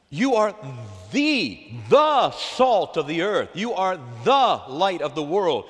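slow, loud, word by word; slow, loud preaching stress; slow emphatic. Real, not acted. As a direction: spell it out for the church. Preacher hammering the point.

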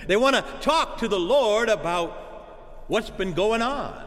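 ordinary delivery

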